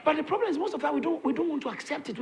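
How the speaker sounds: ordinary delivery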